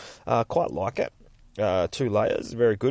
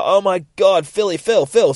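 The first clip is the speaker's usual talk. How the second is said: high, loud alarmed calling; panicked cry; 10 semitones higher, 9 dB louder than usual. Retold or imitated. Wife finding husband down after the crash, panicked cry. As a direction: cry out his name in acted panic.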